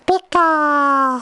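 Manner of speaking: high, held creature cry; high-pitched Pikachu call. Acted. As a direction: hold the last vowel high like the Pokemon. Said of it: "The Pikachu I do".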